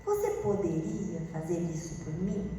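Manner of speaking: soft, gentle asking; polite request. Shown as a demonstration. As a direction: ask kindly, lifting at the end. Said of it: "quando alguém fala assim". (pedido gentil e educado)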